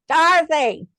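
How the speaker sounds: hammed-up shout of a grumpy old woman; imitating a scream. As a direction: call it out across the room like a cranky old lady. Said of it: "she would scream out".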